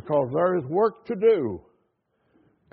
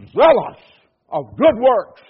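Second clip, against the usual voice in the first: zealous burst out much louder; emphatic; 5 semitones higher, 10 dB louder than usual. Acted. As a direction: hit zealous hard and loud. Climax of read verse.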